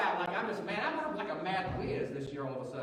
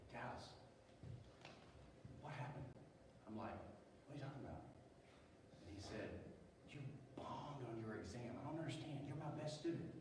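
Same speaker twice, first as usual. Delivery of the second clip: hushed talk; 5 semitones lower, 15 dB quieter than usual. Retold or imitated. Coach talks quietly outside room.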